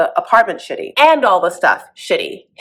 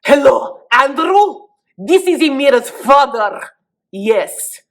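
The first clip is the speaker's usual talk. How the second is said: slow, loud, higher mock-Egyptian dad; badly faked Egyptian accent, stern and deliberate; 5 semitones higher, 4 dB louder, slower than usual. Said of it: "I actually cannot do an Egyptian accent".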